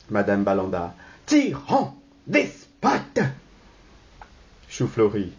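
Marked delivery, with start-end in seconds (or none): 1.3-3.2 high, indignant name-calling. Acted. Wife rebukes husband who restrains her, indignant. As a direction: hurl each insult separately.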